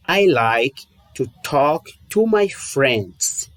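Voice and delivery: "I like to talk to my friends" spoken slowly, word by word; slow model sentence. Shown as a demonstration. Teacher models the English sentence slowly for students. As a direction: read it out slowly for the learner to copy. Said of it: "Vou te dar um exemplo".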